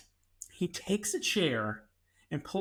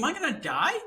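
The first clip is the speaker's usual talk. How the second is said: high, loud mock fear; mock panic; 10 semitones higher, 4 dB louder than usual. Locked in a room with roommate, mock panicked. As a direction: squeak it out like you're doomed. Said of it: "I was like".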